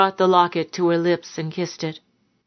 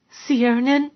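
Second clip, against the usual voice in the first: high, fearful whimper; 6 semitones higher than usual. A narrator's reading. Frightened woman checking on her lover, whimpering. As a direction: whimper the name up high, questioning. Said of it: "she whimpered".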